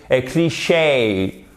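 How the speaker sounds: cliché said slowly the English way, stress on the last syllable; cliché pronounced kli-SHAY like an English word. Shown as a demonstration. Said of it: "au lieu de un cliché". (cliché à l'anglaise, accent sur la dernière syllabe)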